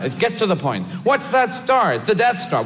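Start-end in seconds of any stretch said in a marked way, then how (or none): 1.0-1.9 mock question. Mock dialogue about Death Star.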